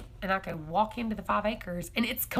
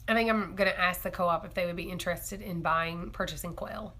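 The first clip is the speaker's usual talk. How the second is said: ordinary talk, nothing marked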